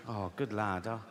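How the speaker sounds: low, soft proud-father murmur; fond dad. Acted. Dad fondly praising favourite son Jack.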